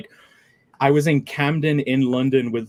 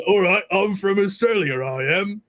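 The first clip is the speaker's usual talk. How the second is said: higher, put-on Australian accent; mock an all-purpose Australian accent; bad Australian accent; 5 semitones higher than usual. Friend parodies his catch-all foreign voice, bad Australian accent. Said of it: "That's Phil's Australian accent".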